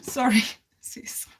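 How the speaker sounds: slow whispered apology with a laugh. Real, not acted. Apologises to a viewer for drinking on live. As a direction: whisper sorry through a giggle.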